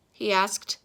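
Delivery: ordinary delivery, nothing marked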